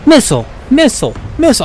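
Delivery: high, fast alarmed callout; alarmed warning. Real, not acted. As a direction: panic call of missiles. Warning of incoming missiles.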